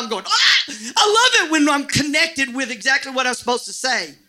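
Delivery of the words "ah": high, sudden delighted yelp; excited cry. Retold or imitated. Preacher retelling delight at the connected service, excited cry. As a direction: yelp it out, pitched way up.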